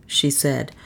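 ordinary delivery

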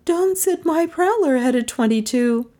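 high female character voice; pitched well above the narrator; Melissa's voice. A narrator's reading. Melissa passing on what Don said, high voice.

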